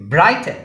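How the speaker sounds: Brighten with a loud, high first syllable; first-syllable stress. Shown as a demonstration. Teacher modelling stress, first syllable of brighten punched. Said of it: "first syllable ആണ് stress".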